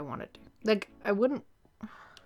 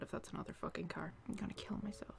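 frustrated whisper; 13 dB quieter, faster than usual. Real, not acted. Frustrated by car noise.

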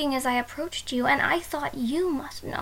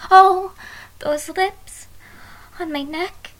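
startled gasp; 4 semitones higher, 6 dB louder, slower than usual. Acted. Bride gasps at kisses on neck.

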